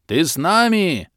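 loud yell of a name over the wind. A narrator's reading. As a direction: bellow the name across the wind. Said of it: "перекрикивая ветер". (Бом вопит, перекрикивая ветер)

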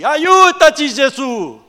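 drawn-out high shout for help; slow, full-force desperate begging; desperate plea. Acted. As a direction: cry out loudly in desperation. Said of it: "quel cuore di un padre disperato".

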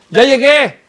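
grito agudo y fuerte; llegada anunciada a voces. Acted. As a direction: anuncia tu llegada a toda la casa. (saludo gritado al llegar)

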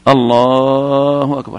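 الله أكبر with a very long "laa" in الله; over-lengthened takbir. Shown as a demonstration. Teacher warning imams against dragging the takbir, over-lengthened الله. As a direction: drag out the middle of الله, then a quick أكبر. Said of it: "ألا يمد إيش؟".

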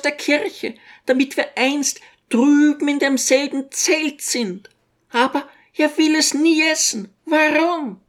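put-on a fretting little old woman doting on her son; old mother's voice. A narrator's reading. Old mother fussing over her son to the guests, high-pitched.

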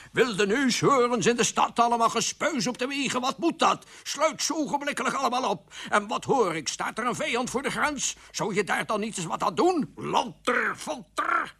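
high-pitched royal tirade; ranting scolding. Acted. Changed king scolds his minister, high angry rant. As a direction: rattle off furious orders and rebukes. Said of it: "Hij stond te schelden".

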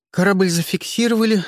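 plain reading voice

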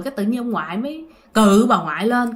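ordinary delivery

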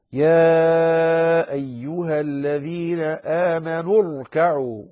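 slow tajweed chant with a long held opening; Quranic recitation. Shown as a demonstration. Teacher reciting verse to show joined reading, slow chant. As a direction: recite slowly, sustaining the first vowel.

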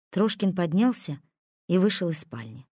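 usual speaking voice

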